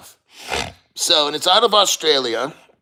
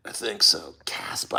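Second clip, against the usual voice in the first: breathy whisper to himself; 10 semitones lower, 8 dB quieter than usual. Real, not acted. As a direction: whisper it under your breath. Muttering to himself mid-stream.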